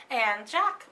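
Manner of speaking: ordinary delivery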